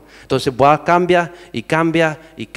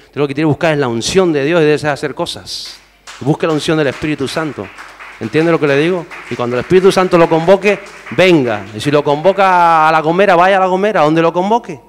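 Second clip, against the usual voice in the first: loud rising exhortation, pitched higher; preaching at full force; 4 semitones higher, 5 dB louder than usual. Acted. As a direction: call the congregation to go wherever the Spirit sends.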